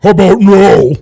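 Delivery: loud flat dismissal; loud retort. Real, not acted. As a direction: shut the question down loudly.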